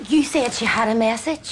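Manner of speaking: loud, theatrical medium in a trance; a fake medium channelling loudly; dramatic psychic. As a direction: ham up the vision, gasping.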